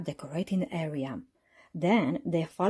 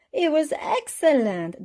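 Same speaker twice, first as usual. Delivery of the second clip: chuckling; 9 semitones higher, 5 dB louder than usual. A narrator's reading. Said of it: "Daddy Pig chuckled".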